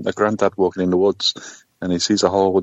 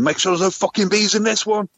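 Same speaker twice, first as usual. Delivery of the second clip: punchline voice; 13 semitones higher than usual. Retold or imitated.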